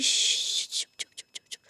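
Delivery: whispered, very soft shushing; hushing. Acted. Teacher quieting a crying student, hushing whisper. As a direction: hush someone tenderly under your breath.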